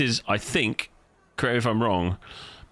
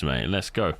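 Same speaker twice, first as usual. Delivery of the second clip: ordinary talk, nothing marked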